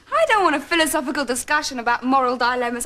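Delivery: normal talking voice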